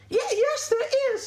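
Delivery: very high, loud sheep squeak; insistent sheep. Acted. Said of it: "said Sally".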